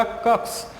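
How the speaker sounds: 'कक्स' as an isolated X sound, trailing hiss; phonics-style 'कक्स', ending on a voiceless ks; letter-sound demo. Shown as a demonstration. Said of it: "pronunciation क्या हो जाएगा".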